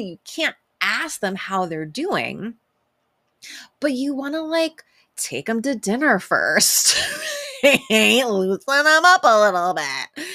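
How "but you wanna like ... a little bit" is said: 1 teasing innuendo. Pretend. Joking about warming people up.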